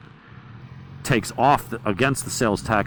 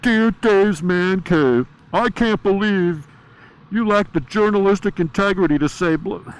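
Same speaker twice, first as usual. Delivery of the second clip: high, slow, whiny know-it-all voice; mock the nitpicking commenter; nasal-free sneering parody of a fan letter; 9 semitones higher, 3 dB louder, slower than usual. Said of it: "someone's gonna tell me".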